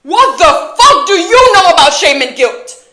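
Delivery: high, loud outburst of outrage; explode, yelling the question at them; furious shout. Acted. Same question, now exploding.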